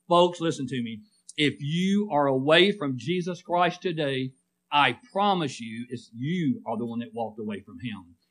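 normal talking voice

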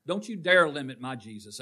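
normal talking voice

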